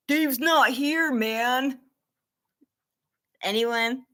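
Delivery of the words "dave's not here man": slow, drawled stoner line quoted from a movie; lightly voiced stoner, slow and lazy; movie quote stoner voice. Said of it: "can anyone tell me what movie that's from".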